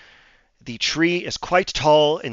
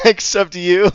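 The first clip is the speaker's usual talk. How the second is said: laughing; 8 semitones higher, 10 dB louder than usual. Real, not acted. Teasing Hayward about vanishing.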